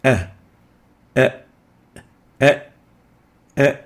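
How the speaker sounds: a hamza with choking added; عين حلقية; ع with a choking catch. Shown as a demonstration. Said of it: "with a choking added to it".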